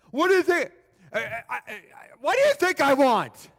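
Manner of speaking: high, loud sputtering comeback; stammer, then burst out exasperated; incredulous beggar. Acted. Blind beggar retorts to Jesus's question, sputtering with exasperation.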